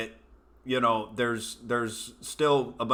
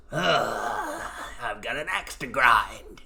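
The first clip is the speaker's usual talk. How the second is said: demon troll; slower than usual. Retold or imitated. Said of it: "this fucking demon troll".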